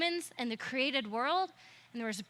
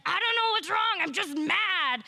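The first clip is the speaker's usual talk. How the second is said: louder, higher, a hammed-up huff; mock angry guy; 5 semitones higher, 5 dB louder than usual. Preacher voicing an angry figure on the flannel board, mock huffy. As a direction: play the cartoon grump who's mad for no reason. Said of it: "He's like".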